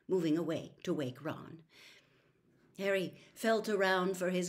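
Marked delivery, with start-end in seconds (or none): none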